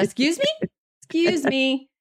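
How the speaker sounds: mock outrage, pitch jumping up; indignant excuse me. Real, not acted. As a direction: act scandalized by what you just heard. Mock offense at Paula's line.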